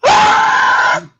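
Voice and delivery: very high, loud shriek; mock scream. Acting out a cobra bite with a loud high scream. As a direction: shriek as if bitten.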